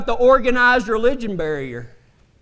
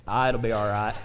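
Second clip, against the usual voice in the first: lower, offhand, can't-be-bothered tone; careless shrug; 13 semitones lower, 7 dB quieter than usual. Retold or imitated. Cowboy shrugging off a lot of gas, offhand. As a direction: brush the danger off lazily.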